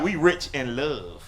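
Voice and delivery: a hint of a tender grandmother; grandma's comfort. Grandmother consoling poor kids, soft and slow. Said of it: "She used to tell us stuff like".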